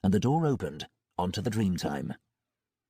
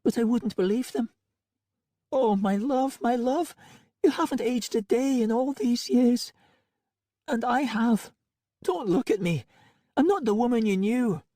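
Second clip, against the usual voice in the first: high, aching voice of a grieving wife; lightly tearful, higher woman's voice; tearful wife Angelica. Acted. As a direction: play her as a broken woman reunited with her love. Voices Angelica, high female figure.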